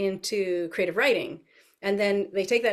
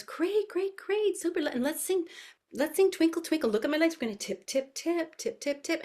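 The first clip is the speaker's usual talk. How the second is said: put-on very high, playful toddler-class chatter; high-pitched preschool teacher; animated teacher voice; 9 semitones higher than usual. Shown as a demonstration. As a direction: cheer the little ones along in a high sing-song. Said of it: "animated voice".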